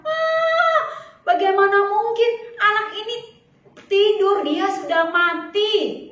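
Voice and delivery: high, loud scoffing crowd; mocking disbelief. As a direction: scoff at the idea. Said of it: "menertawakan dia". (orang-orang mengejek tak percaya)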